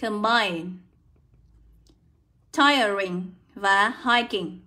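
"combine", "tiring", "hiking" one by one with an eye vowel; careful, spaced "combine", "tiring", "hiking"; pronunciation demo. Shown as a demonstration. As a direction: slowly model "tiring" and "hiking" with long i. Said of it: "âm oi".

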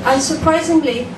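ordinary talk, nothing marked

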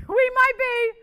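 high, loud laughing repeat, slowed. Real, not acted. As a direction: laugh it out at the stalled bid. Laughs at stalled bid.

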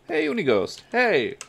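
high sustained "hey there" call; hailing someone. Acted. In character, hailing Unighost.

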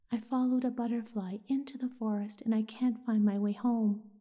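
ordinary delivery

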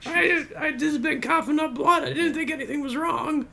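put-on high croaky old smoker. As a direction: play a dying chain smoker. Mock smoker skit.

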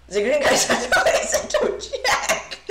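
loud, high, breaking into laughter; laughing. Real, not acted. Streamer joking about the green guys, laughing. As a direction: laugh through the words.

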